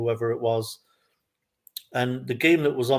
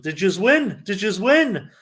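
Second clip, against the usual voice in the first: higher, louder mock northern Irish heckle; thick northern Irish lilt, swooping high; thick northern Irish accent; 7 semitones higher, 5 dB louder than usual. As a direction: imitate a drunk northern Irish fan pestering. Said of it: "in that thick northern accent".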